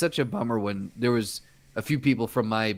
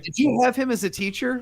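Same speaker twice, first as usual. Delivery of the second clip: imitating mom; 10 semitones higher than usual. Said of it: "she's like".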